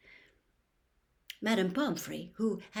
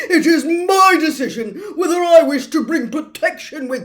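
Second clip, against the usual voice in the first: much louder, higher indignant rant; angry minister; 8 semitones higher, 17 dB louder than usual. Acted. Minister ranting at McGonagall, angry and loud. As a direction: bluster with wounded pride. Fudge roaring on.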